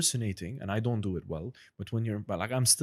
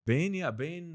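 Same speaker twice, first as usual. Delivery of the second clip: usual speaking voice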